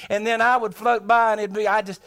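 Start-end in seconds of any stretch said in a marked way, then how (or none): none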